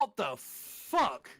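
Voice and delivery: loud, slow, drawn-out shout. Acted. Reader hams up a character's shocked shout. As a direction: bellow it in disbelief. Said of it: "he shouted".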